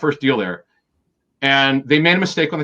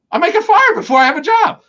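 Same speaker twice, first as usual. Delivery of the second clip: sharply higher, louder imitated dread; mock panic; 6 dB louder than usual. Recalling pacing the cafeteria to the host, mock panic. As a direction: replay the nerves, voice flying up.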